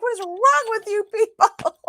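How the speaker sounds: high-pitched exasperated mock scream; reenacted screaming. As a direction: imitate yourself screeching at the family. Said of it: "top of my lungs screaming".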